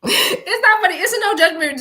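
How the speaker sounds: high, loud, fighting back laughter; laughing. Real, not acted. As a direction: insist it's not funny while laughing. Laughing fit.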